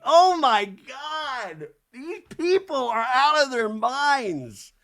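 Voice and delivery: loud, high, drawn-out wail of disbelief; exasperated outburst. Real, not acted. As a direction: cry out in disbelief, stretching each word. Host's own exasperated outburst.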